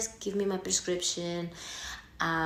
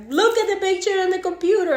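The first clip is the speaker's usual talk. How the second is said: high, loud, fast mimicked doctor; mock bossy doctor; 13 semitones higher, 8 dB louder, faster than usual. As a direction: bark the order in a high squeak. Doctor quoted by patient.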